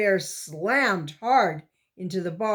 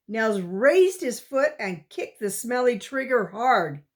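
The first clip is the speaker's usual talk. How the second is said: usual speaking voice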